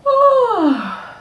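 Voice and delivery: high, breathy yawn sound let out on purpose; long audible yawn, high and falling; noisy demo yawn. Shown as a demonstration. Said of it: "I like to make a noise when I yawn".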